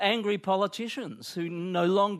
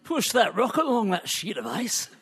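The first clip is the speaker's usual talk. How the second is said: a light, hammed-up Scottish accent, laughing through it; weird Scottish accent; 2 semitones higher than usual. Two mad Scots at the curling rink, mock Scottish accent. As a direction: play a mad Scot in a wobbly Scottish accent. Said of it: "that was a weird accent".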